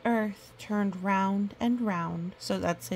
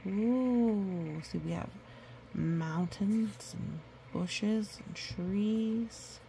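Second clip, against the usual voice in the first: slow wonder; much slower than usual. Real, not acted. Reader shows the picture slowly.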